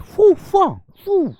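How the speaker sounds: high-pitched mock kung fu shouts; short high martial-arts "foo, faa" yelps; martial arts cries. Teacher imitating martial arts for students, high mock fight cries.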